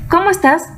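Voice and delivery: "¿Cómo estás?" with a high rising question lilt; question tune. Shown as a demonstration. Teacher showing a question tune to kids.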